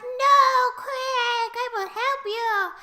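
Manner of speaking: high, drawn-out hammed-up rescue call; mock desperate cry. Friend digging Quake out of sand, mock desperation.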